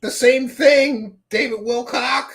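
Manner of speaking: high sneering mimicry; mock him in a high whiny voice; mocking impression. Mocking Wilcock's words.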